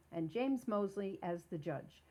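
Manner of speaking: normal talking voice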